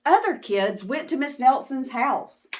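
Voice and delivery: ordinary delivery, nothing marked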